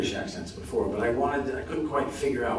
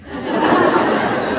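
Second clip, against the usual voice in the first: ordinary talk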